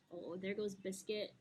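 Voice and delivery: normal talking voice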